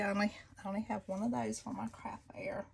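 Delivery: quick, hushed confiding whisper; rushed little whisper to the viewers; whispered aside. Real, not acted. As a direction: let this slip out as a fast secret aside. Whispered aside about craft fair.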